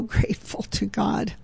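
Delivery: real emotion catching in the voice, a half laugh; getting emotional. Real, not acted. Radio host thanking God for listener support, getting emotional. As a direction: let gratitude choke the words a little. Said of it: "I'm just getting emotional".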